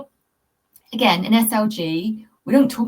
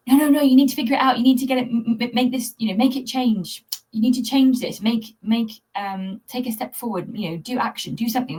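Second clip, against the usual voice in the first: pushy inner voice, hurried; nagging inner voice; 4 semitones higher than usual. Retold or imitated. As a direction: rush and push like a bossy inner voice. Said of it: "the head part of me was like".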